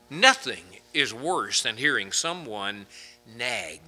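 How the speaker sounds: usual speaking voice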